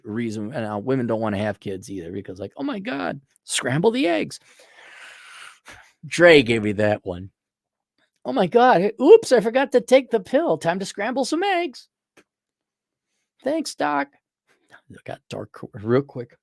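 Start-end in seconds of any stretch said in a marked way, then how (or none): 2.6-4.4 high, breathy-free mock girly voice; mocking a woman who skips the pill; mock ditzy woman. 8.2-11.9 high, breathy-free mock girly voice; mocking a woman who skips the pill; mock ditzy woman. Mocking women again, high voice. 13.4-14.1 mock girly thanks. Still high mock voice.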